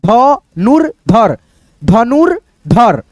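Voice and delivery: धनुर्धर drawn out slowly, र sounded before ध; slow syllable demo. Shown as a demonstration. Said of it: "र, र पढ़ो पहले". (धनुर्धर को बहुत धीरे, र पहले बोलकर)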